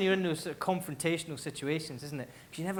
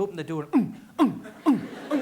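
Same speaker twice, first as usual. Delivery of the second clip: gag like a cat about to be sick; mocking a cat heaving up; cat retching; 3 semitones higher, 4 dB louder than usual.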